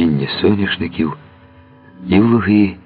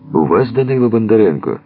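calling out; 6 semitones higher than usual. A narrator's reading. Man calls through window at night.

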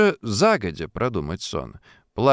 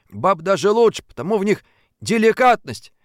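higher, earnest; eager flattery; 9 semitones higher, 5 dB louder than usual. A narrator's reading. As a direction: say it sincerely to please her. Fadeykin's original line.

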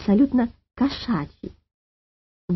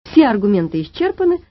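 ordinary delivery, nothing marked

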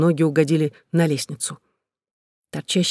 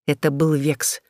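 ordinary delivery, nothing marked

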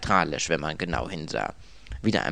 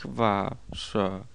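awkward, apologetic servant; sympathetic, slowing towards the end; murmured apology; 5 semitones higher, slower than usual. A narrator's reading. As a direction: offer regrets after clearing the throat. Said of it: "murmelte er".

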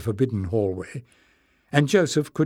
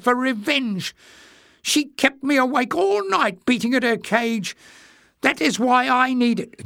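very high, peevish squeak; high-pitched indignant grievance; furious rant; 13 semitones higher than usual. A narrator's reading. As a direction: complain bitterly, far up in pitch. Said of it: "creased into a furious scowl".

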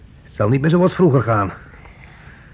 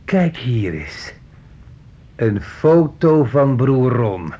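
slow menacing drawl; much slower than usual. Acted. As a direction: linger on each word like a threat. Son menacingly inspects old photos.

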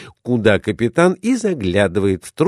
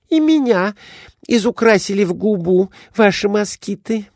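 higher, quick eager lady; lady's voice; 11 semitones higher than usual. A narrator's reading. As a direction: chirp it out like a fussy wife. Glafira complains.

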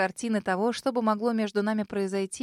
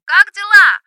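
high, loud yell into the phone; shouting over music; 11 semitones higher, 19 dB louder, slower than usual. Acted. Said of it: "заорала в трубку Соня".

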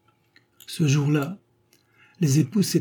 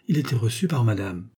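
ordinary delivery

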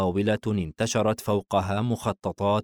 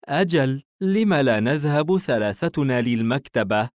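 mildly eager, higher-pitched agreement; enthusiastic mother; 5 semitones higher, 3 dB louder than usual. A narrator's reading. Mother backing the family library trip, mild enthusiasm. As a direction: chime in as the mother, pitch lifted. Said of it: "الأم بحماس".

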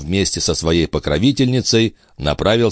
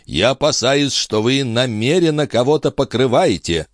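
objecting; 8 semitones higher than usual. A narrator's reading. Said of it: "возразил начальник полиции".

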